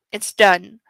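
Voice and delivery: usual speaking voice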